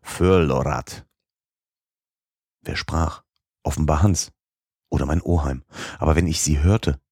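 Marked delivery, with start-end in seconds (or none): 0.0-1.1 Förlorat said slowly, each syllable weighted with pain; painful emphasis. A narrator's reading. Repeating the strange word heard through the rock, slow and pained. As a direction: lean on Förlorat like a word that hurts. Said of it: "mit schmerzlicher Betonung hörte ich das Wort".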